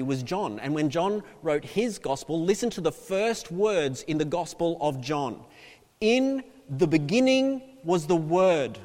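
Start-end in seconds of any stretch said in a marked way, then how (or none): 6.0-8.8 louder, measured pulpit reading; declaimed scripture. Acted. Preacher reading John's gospel aloud, declaiming. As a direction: proclaim the verse with weight on each word.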